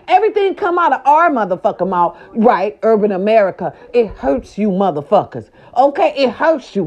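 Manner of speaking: usual speaking voice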